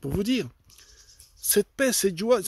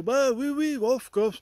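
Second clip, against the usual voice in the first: a higher, lightly put-on voice for the old neighbour; neighbour's reply; 8 semitones higher, slower than usual. Retelling the old neighbour's answer on the doorstep, a lightly higher voice. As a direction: voice the elderly neighbour lightly, pitched up.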